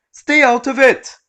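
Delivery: high, loud furious snap; angry snap. Acted. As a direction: bite the customer's head off. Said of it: "she snaps at the customer".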